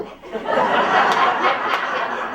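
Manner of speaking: big burst of laughter; loud laugh. Real, not acted. Audience-facing laugh.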